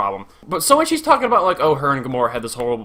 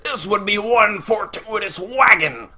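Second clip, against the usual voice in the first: drawn-out, higher movie-quote voice; Hateful Eight impression; 6 semitones higher, slower than usual. Quoting a Hateful Eight line to viewers, hammed-up impression. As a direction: savour it slowly as a quoted film line. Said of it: "from the Hateful Eight, it's like".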